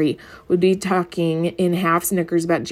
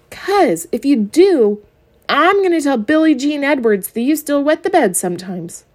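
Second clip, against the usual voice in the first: louder, higher menacing threat; threatening; 8 semitones higher, 4 dB louder than usual. Acted. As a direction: lean in and threaten. Brother threatens little sister.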